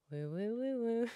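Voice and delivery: airy spooky woo; ghost voice. Pretend. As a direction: hoot like a playful ghost. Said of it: "my impression of a spooky ghost".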